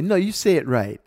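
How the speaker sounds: loud, high imitated challenge; retold taunting. Man with French name taunting him, retold loud challenge. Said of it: "taunting me".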